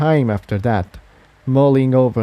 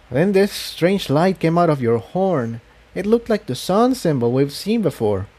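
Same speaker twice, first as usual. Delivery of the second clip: higher mare voice, earnest and a bit awed; higher filly voice telling what she saw; Tiptoe; 6 semitones higher than usual. A narrator's reading. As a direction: voice her higher, a young mare explaining. Tiptoe explains the strange light.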